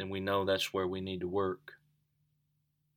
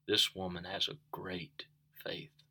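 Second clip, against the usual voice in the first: whisper; 7 dB quieter than usual. Retold or imitated. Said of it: "That's when Jesus said".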